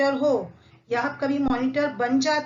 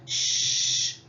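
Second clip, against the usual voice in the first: shushing; much slower than usual. Shown as a demonstration. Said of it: "मुंह पे उंगली रख के बोलते हैं ना".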